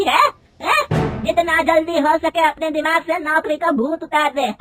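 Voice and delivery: mother-in-law berating her daughter-in-law, loud and high; angry scolding. A narrator's reading. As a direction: scold her hard for wanting a job. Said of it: "गुस्से में कहती है".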